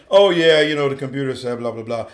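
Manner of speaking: low, tossed-off parroting; mock bored recital; mocking know-it-all. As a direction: rattle it off dismissively, trailing away. Mocks people quoting the computer.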